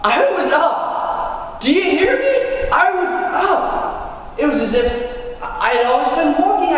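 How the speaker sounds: high, slow ecstatic exclaiming; overjoyed. Acted. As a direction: burst out with joy at walking. Joy at being healed.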